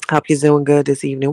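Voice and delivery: ordinary delivery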